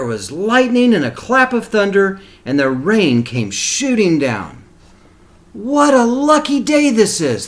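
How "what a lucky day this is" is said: high, bright-eyed cry of joy, louder; excited donkey. A narrator's reading. Young donkey Sylvester, thrilled by the magic pebble, squeals with joy. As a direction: squeal it out in delight at his luck. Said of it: "thought Sylvester".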